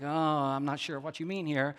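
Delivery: mock puzzlement. Nicodemus unsure what Jesus means, quietly puzzled. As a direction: play at being unsure, keeping it quiet.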